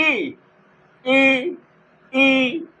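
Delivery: ई repeated, tongue against the palate; repeated demo of the palatal vowel ई. Shown as a demonstration. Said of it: "तो मैंने बोला ई".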